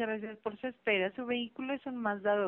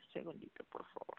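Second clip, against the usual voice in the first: whispered aside; 13 dB quieter than usual. Real, not acted. Aside while checking system.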